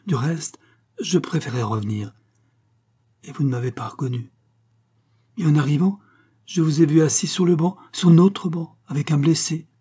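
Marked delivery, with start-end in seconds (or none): 5.4-9.7 rising jealous reproach. Acted. Jealous lover reproaches her.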